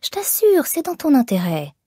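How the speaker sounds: high wheedling start, sinking back down; coaxing. A narrator's reading. Man pressing a young woman to give in, coaxing. Lecher keeps coaxing her.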